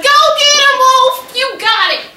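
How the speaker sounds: high, loud cheer across the yard; encouraging yell. Retold or imitated. Neighbor yelling to shy Wolf from the porch, high encouraging yell. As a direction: holler it out to urge him on. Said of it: "but I yelled at him".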